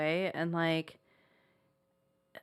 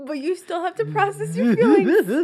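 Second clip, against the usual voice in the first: teasing therapist imitation; 9 semitones higher, 9 dB louder than usual. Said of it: "You're like".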